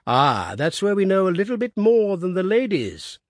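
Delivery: hearty cry. A narrator's reading. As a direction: call it out heartily to the old man. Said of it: "cried the boss".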